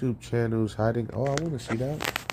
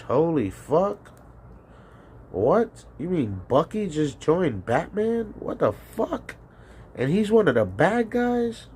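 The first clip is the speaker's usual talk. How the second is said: incredulous; 6 semitones higher than usual. Real, not acted. Stunned by the casting news.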